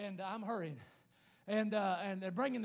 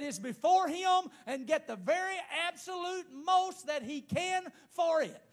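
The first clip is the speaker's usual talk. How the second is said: raised, emphatic preacher's delivery; forceful preaching; 5 semitones higher, 7 dB louder than usual. Real, not acted. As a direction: hammer the point home, high and loud.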